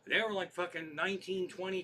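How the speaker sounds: ordinary talk, nothing marked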